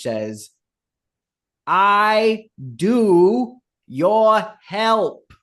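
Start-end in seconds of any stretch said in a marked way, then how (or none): 1.7-5.3 put-on slow, high, loud mangled French-accented English; bad French accent. Woman to Torben, halting in a bad French accent. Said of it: "in very bad French".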